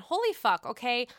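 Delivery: usual speaking voice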